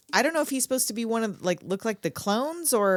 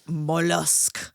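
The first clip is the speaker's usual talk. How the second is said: slow creepy voice; 4 semitones lower than usual. Retold or imitated. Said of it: "the voice is so".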